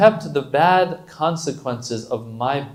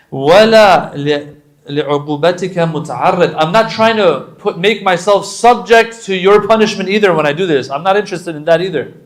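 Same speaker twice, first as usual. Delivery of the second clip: impassioned preaching; 6 semitones higher, 10 dB louder than usual. Real, not acted.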